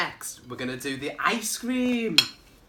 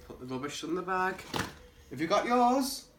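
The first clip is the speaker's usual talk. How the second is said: ordinary talk, nothing marked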